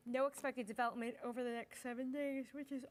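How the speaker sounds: words dragged by a yawn. Real, not acted. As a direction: talk while yawning. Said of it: "I can't stop yawning".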